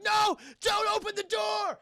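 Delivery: high, loud, slow alarmed shout; frantic warning. Acted. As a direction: plead desperately to stop him. Orderly warns Batman.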